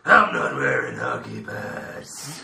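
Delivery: low, slow, growled Batman line; gruff Batman voice. Acted. Quoting Batman at a fake Batman, low and growled. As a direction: growl it like the caped hero. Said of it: "four quotes from superhero films".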